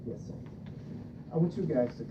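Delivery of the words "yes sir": ordinary delivery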